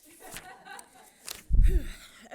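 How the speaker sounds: loud shaky exhale into the mic; big nervous breath out before starting; nervous sigh. Real, not acted. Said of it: "I'm very nervous".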